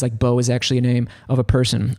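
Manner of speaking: ordinary delivery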